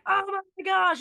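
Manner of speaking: shocked gasp of a fooled passenger; high startled oh my gosh; a quick burst of mock panic; mock alarm. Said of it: "people would be like".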